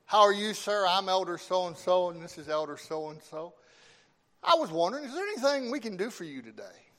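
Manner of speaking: put-on loud, high, chipper young missionary; eager door-to-door greeting. Acted greeting of visiting young elders.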